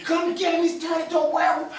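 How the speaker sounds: terrified cellmate yelling about a werewolf; panicked yelling. Retold or imitated. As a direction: scream for someone to come before he changes. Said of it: "I'm yelling".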